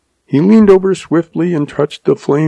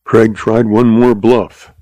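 usual speaking voice

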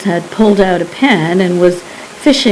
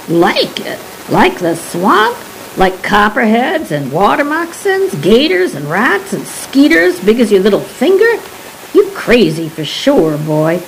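lightly voiced Cajun old man, scoffing at the idea; old Cajun recluse; 3 semitones higher than usual. Old Jean Pearl scoffs at the guide's question, lightly Cajun.

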